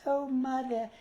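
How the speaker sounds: put-on high childlike whine; Tiny Tim voice. Showing hosts how he talked as Tiny Tim, high and childlike. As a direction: play the sweet little boy calling his mother. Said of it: "I would talk like this".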